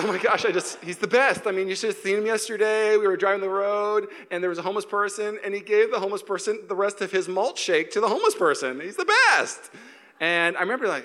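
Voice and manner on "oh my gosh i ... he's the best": lightly higher-voiced doting parent, climbing at the end; hammed-up parental adoration, peaking high; gushing parent. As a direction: brag breathlessly about your perfect child.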